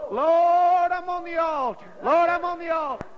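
high, drawn-out call to the Lord; retold prayer cry. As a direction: stretch out "Lord" as her fervent plea. Imitating her repeated inner prayer cry.

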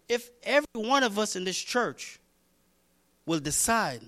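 usual speaking voice

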